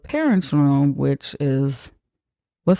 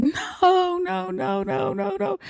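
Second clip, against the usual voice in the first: high, softer, wailing no's; crying no; 5 dB quieter, slower than usual. Retold or imitated. Girl on the phone after the tragedy, mock crying. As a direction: wail the no's in a mock cry. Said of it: "she's crying really loud".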